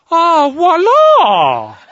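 very high, loud, drawn-out French exclamation; sudden revelation. Retold or imitated. French steward seeing the man is six-foot-ten, mock amazed cry. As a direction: cry out in hammed-up French astonishment. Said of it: "Like it's suddenly a new revelation".

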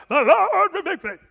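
hammed-up shouted cry, laughing; mock cry out. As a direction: wail it out like an over-the-top preacher. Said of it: "It didn't say he cried out".

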